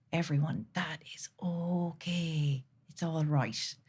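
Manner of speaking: slow whispered comfort; slow tender whisper; soothing whisper. Real, not acted. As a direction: whisper it gently to calm them. Consoling audience.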